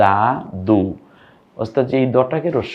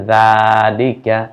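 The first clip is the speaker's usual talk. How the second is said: slow blending; 4 dB louder, much slower than usual. Shown as a demonstration. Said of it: "মিলে হয়".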